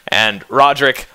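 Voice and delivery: ordinary talk, nothing marked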